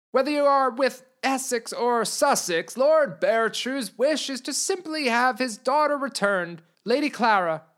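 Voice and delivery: simpering messenger; weaselly envoy. Acted. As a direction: coax like a sneaky herald. Portly envoy speaking again.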